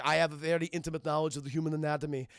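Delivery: put-on Austrian-accented cyborg delivery; Arnold. Cyborg patching up Sarah's wound, stiff Austrian accent. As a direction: imitate a matter-of-fact Austrian-accented machine. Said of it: "Arnold's patching her up".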